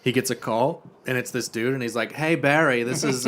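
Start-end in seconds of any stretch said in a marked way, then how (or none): 2.1-3.3 lilting, camp phone greeting; lightly camp voice. Retold or imitated. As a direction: put on a campy foreign henchman. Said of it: "he kinda sounds like that".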